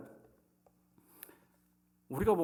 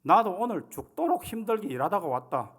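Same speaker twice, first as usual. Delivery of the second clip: louder, higher Gyeongsang dialect grumble; gruff Gyeongsang husband; 4 semitones higher, 4 dB louder than usual. Retold or imitated. Husband snapping back at his weary wife in Gyeongsang dialect. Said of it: "뭐 이래 나오는 거 아닙니까?".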